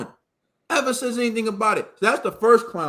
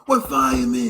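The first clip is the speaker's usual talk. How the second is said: lightly hammed-up boxing cornerman, low and strained; mock a melodramatic corner speech; wrestling-promo parody; 7 semitones lower, 3 dB louder than usual. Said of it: "he's the pallbearer".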